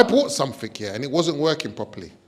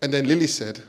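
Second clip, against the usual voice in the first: normal talking voice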